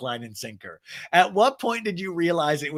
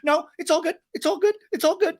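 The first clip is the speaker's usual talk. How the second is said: forced calm; 3 dB louder, faster than usual. Retold or imitated. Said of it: "Shannon's like".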